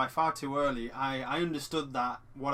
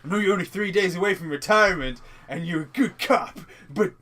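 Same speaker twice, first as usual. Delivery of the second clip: hammed-up captain, higher and much louder; loud police chief; 5 semitones higher, 10 dB louder than usual. Mocking a cliché cop film, the chief lecturing a cop near retirement, loud and hammed-up. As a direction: play the cliché boss lecturing his cop.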